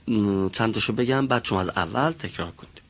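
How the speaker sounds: ordinary talk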